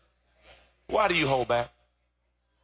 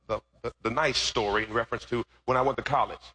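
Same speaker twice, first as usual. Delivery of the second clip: ordinary talk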